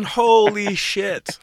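drawn-out, high "holy shit"; slow, amazed "holy shit"; stunned disbelief. Real, not acted. Stunned reaction to wild story.

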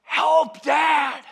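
high shout for help from the water; retold cry for help. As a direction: yell it out like a drowning boy calling his dad. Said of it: "And he yells to me".

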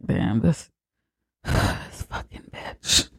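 sighing, half-whispered, fed-up grumble under the breath; a lightly mimicked annoyed mutter, low and soft. Family fed up with the mom, grumbling under their breath.

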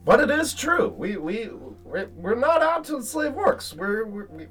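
nervous squeaky voice. Acted. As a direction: babble yes nervously to please. Squeaky character continues talk.

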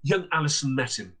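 ordinary delivery, nothing marked

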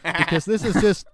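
ordinary talk

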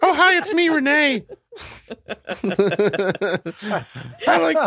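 very high, laughing mock voice; squeaky put-on impression through laughter; impersonating Renee. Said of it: "Renee, would you like to say something?".

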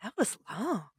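mock moviegoer's drawn-out remark; lightly acted audience grumble; imitated moviegoer reaction. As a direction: stretch out "long" with a swoop in pitch. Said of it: "walking out of the theater going".

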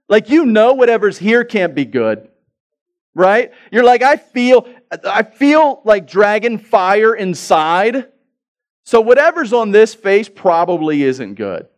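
loud, high, worked-up mock fury; acted anger. Preacher showing how being mad feels, acted anger. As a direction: build to a drawn-out, hammed-up dragon-fire rage. Acts out feeling mad inside.

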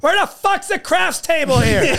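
mock entitled comic. Mocking fellow comic's question.

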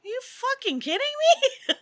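high-pitched mock disbelief; exaggerated "are you serious" reaction; incredulous outburst. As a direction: retell it as a high, incredulous squeal. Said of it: "I'm like".

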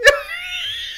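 high, helpless burst of laughter; squealing laugh; real burst of laughter. Real, not acted. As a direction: crack up. Laughs after apologising.

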